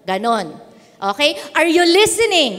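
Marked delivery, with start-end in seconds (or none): 1.6-2.6 loud, raised call to the crowd. Real, not acted. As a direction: check the audience is with you, loudly. Calls out to the audience.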